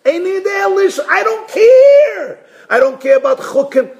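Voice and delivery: emphatic indifference. Acted. Child who has no questions, shouting indifference. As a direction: cry it out, refusing to engage.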